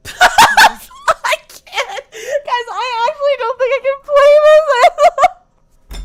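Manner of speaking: high, laughing helplessly; laughing through tears. Real, not acted. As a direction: crack up while crying with laughter. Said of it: "I'm actually crying".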